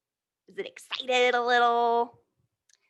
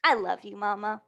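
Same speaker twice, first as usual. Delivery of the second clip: gentle picture-book read-aloud; storybook reading voice; reading to children. Acted. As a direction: read it like a bedtime story to little ones.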